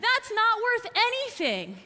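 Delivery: acted merchant's taunt; mocking jeer. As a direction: scoff at a worthless offering. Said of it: "they laughed and laughed".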